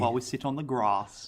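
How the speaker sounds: grass said with an "ah", like grahss; long A in grass. Shown as a demonstration. Host shows South Australian speech, grass with long A. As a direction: lengthen the vowel in grass to "ah". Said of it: "We use the long A".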